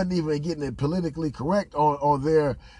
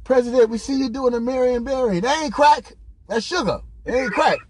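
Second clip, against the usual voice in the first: high mock protest; 6 semitones higher, 4 dB louder than usual. Acting out accusation and president's denial.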